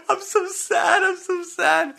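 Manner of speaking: high, loud mock sob; mock wife whimper. Husband mocking his upset wife, high whiny sob. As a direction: wail like a heartbroken wife.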